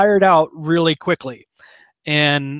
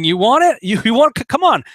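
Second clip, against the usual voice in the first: high, loud taunting come on; taunting God; 7 semitones higher, 5 dB louder than usual. Retold or imitated. God dares Pharaoh to keep coming, mock taunt. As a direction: egg him on like a fighter. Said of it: "He's saying".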